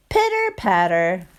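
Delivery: ordinary delivery, nothing marked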